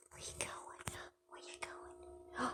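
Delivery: hushed, slow whisper to a bird; soft whisper. Real, not acted. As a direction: breathe it softly, almost no voice.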